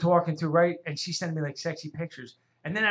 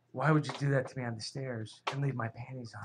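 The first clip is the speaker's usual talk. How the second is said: low, soft imitation of her complaint; understated accusing tone, a hint of amusement; mild retold reproach; 7 semitones lower, 9 dB quieter than usual. Woman reproaching her date by phone, softly mock-serious.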